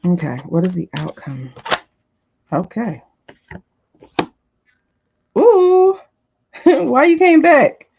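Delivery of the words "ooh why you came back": much higher and louder, a laugh in it; loud surprised ooh. Real, not acted. Reader reacting to a card coming back, loud surprised squeal.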